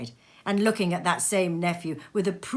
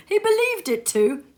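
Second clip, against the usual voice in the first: higher, louder, amused; amused nephew; 14 semitones higher, 6 dB louder than usual. Acted. Nephew mocking his uncle, higher and amused. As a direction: tell it with a grin.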